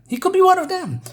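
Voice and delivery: high, loud accusing cry; alarmed shout. Acted. Sheriff's accusation in the scene.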